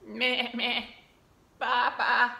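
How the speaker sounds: bleating meh and ba; goat and sheep bleats. Acted. Reading animal sounds aloud.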